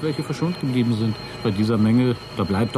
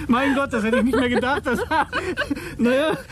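laughing, much higher, in giddy relief; relieved laughter; 11 semitones higher than usual. Acted. Pilot to copilot after the landing, laughing with relief. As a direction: laugh it out, you made it alive.

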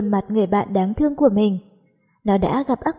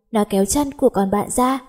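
usual speaking voice

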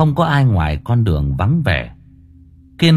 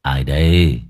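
put-on low, slurred drunken mumble; 7 semitones lower, slower than usual. A narrator's reading. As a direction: drunk man slurring the words. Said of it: "giọng lè nhè".

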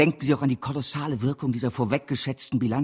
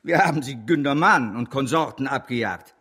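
ordinary talk, nothing marked

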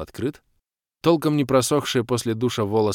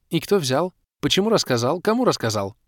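higher-pitched eager grilling; agitated, insistent questioning; barrage of questions; 8 semitones higher, 4 dB louder than usual. A narrator's reading. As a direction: fire off questions one after another. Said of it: "Вопросы так и посыпались".

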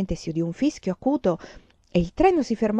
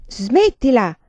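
louder, higher stop it; sharp scolding; 5 semitones higher, 9 dB louder, much slower than usual. A narrator's reading. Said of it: "la sgridò Ciccia". (rimprovero secco, più forte)

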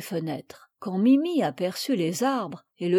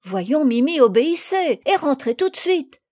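higher, louder stern command; mock-stern call to obey; firm order; 5 semitones higher, 6 dB louder than usual. Madeleine ordering the parrot inside, stern.

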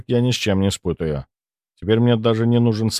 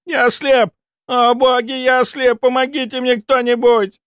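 panicked; 4 dB louder than usual. Acted. Blinded student panics.

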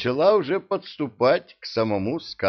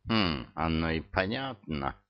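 snooty bored cat; 4 semitones lower, 6 dB quieter than usual. A narrator's reading. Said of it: "фыркнул кот".

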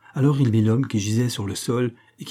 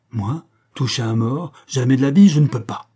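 raised, vehement refusal; loud refusal; 2 semitones higher, 5 dB louder than usual. Acted. Brute refuses to touch a corpse. (refus catégorique, voix forte)

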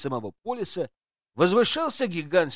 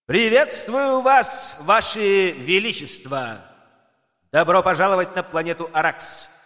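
grand host voice; 3 semitones higher than usual. Acted. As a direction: announce like a showman host. Host greets emperors grandly.

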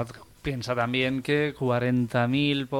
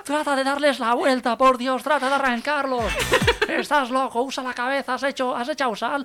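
frantic high-pitched nagging, hammed up; screaming wife. Wife berates husband frying eggs, screaming hysterically high. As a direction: shriek panicky kitchen orders without letup. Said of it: "y a grito pelao le dice".

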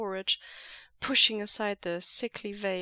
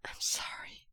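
softer breathy apology; breathed out; 6 dB quieter than usual. A narrator's reading. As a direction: let it out on an exhale. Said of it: "Wei Ying breathes out".

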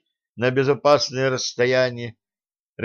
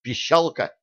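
usual speaking voice

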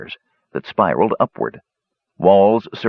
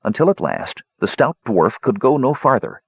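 usual speaking voice

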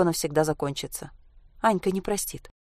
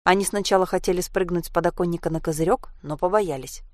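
usual speaking voice

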